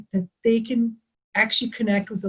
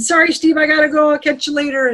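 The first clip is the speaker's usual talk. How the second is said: hurried exclamation; 5 semitones higher, 5 dB louder, faster than usual. Retold or imitated. Rushing off the phone.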